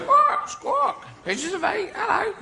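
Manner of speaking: very high parrot voice; parrot squawk. Acted. Parrot on Long John Silver's shoulder, very high squawky voice. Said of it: "'Cause parrots".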